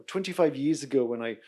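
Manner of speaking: ordinary talk, nothing marked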